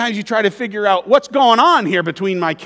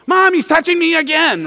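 high, loud whining complaint to mom; whiny tattling little sibling; 11 semitones higher, 6 dB louder than usual. Retold or imitated. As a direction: whine it like a tattling little kid. Siblings tattling in back seat.

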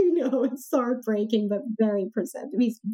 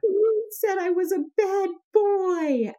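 imitating a hurt ten-year-old, much higher and slow; crying little boy; 11 semitones higher, slower than usual. Son accusing his tired mother, mock tearful and high. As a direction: play the wounded son, voice pitched up. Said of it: "he starts crying".

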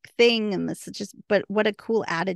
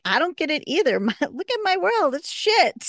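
Greta's mock despair; 10 semitones higher, 3 dB louder than usual. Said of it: "And Greta's like".

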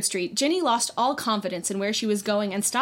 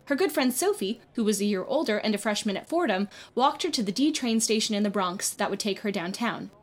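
ordinary delivery

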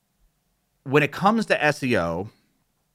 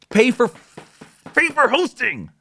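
much higher and louder, pleading; exasperated plea; 13 semitones higher, 5 dB louder than usual. Real, not acted. Fed-up vlogger urging viewers to pay, higher and louder. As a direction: beg them to just pay. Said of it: "I'm getting upset".

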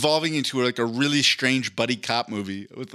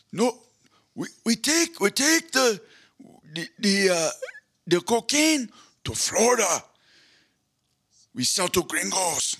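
high squeezed character voice; Yoel Romero voice; 8 semitones higher than usual. Acted. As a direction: voice the gnarled old sea turtle smuggler. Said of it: "what will be a line that he says".

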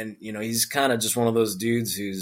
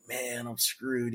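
dejected mutter; 12 dB quieter, much slower than usual. Retold or imitated. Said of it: "I'm like".

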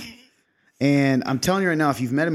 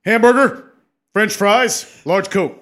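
higher, louder proclamation; booming kingly order; 8 semitones higher, 8 dB louder, slower than usual. Retold or imitated. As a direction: declare each item like a king. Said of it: "It always goes up".

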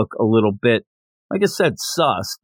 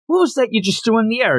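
higher and fast, eager babbling; excited blabbering; 8 semitones higher, faster than usual. Retold or imitated. Tanjiro pestering the silent girl, fast and eager. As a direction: rattle it off, all curious. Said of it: "just a blabbermouth now".

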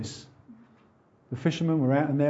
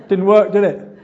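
laughing; 4 semitones higher, 9 dB louder than usual. Real, not acted. Laughing at own joke.

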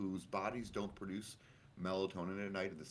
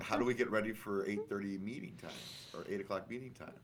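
usual speaking voice

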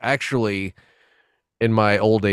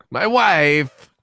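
mock Borat accent; 6 semitones higher, 4 dB louder than usual. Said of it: "Peter Sellers could have been like".

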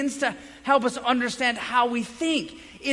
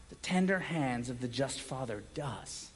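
hushed tender; 8 semitones lower, 10 dB quieter than usual. Real, not acted. Sermon on the Father's tender hands.

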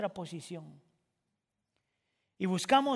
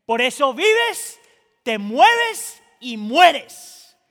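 full-force preaching; 7 semitones higher, 16 dB louder than usual. Acted. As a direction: preach it hard to the church.

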